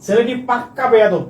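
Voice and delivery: ordinary talk, nothing marked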